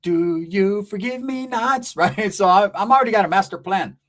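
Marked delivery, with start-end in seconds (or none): 0.0-1.9 slow drawn-out plea. Retold or imitated. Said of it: "I'm gonna go say".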